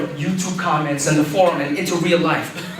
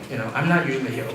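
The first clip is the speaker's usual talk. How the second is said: mumbled aside; 5 semitones lower, 5 dB quieter, much faster than usual. Retold or imitated.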